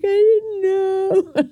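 imitating her younger self in tears; crying child. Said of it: "And I was like".

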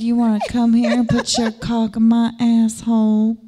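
slow, pleading southern drawl. Shown as a demonstration. Showing the guys a real Southern drawl, pleading southern accent. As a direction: beg politely with a Southern stretch. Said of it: "Like that".

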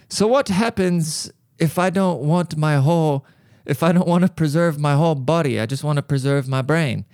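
exaggerated character voice, asking a question; put-on cameraman voice. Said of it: "I'm gonna talk like this".

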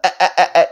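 repeated short laugh noises, put on; imitated laugh. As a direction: copy a mate's stuttering laugh. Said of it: "he does his laugh".

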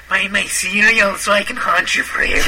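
lightly voiced creepy cereal pitchman; a hint of a haunting whisper; creepy Peter Lorre voice. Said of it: "Peter Lorre".

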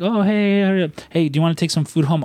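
high, sing-song mock friendliness; coaxing parent. Parent offers food before asking a favour, mock-sweet.

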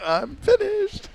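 very high, slow, drawn-out mock voice; hammed-up high-pitched announcement; mock impression. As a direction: stretch it out in a silly high voice. Joking along with the Dixon impression.

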